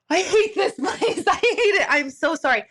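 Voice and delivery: high, laughing mock freak-out; retold panic. Medium apologizing to her friend after screaming, retold panic through laughter. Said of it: "so freaking creepy that I scream".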